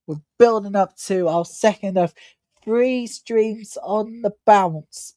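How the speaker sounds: usual speaking voice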